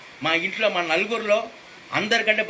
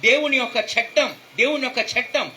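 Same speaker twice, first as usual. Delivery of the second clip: ordinary talk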